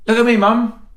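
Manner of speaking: put-on high, loud little-girl call; child calling out. Niece at Wagamama calling her mum, high and loud. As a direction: call out like a five-year-old wanting attention.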